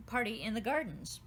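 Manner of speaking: plain reading voice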